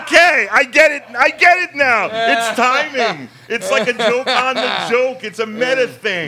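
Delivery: high, loud laughing speech. Real, not acted. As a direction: talk through big laughter. Laughing while explaining the joke.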